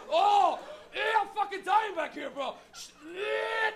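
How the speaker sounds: loud, high mock suffering; back-seat distress. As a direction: cry out from the back seat as if dying. Back-seat kid choking, acted.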